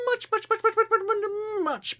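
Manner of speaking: very high, strained much much much; squeaky high. Acted. Emphatic much building up.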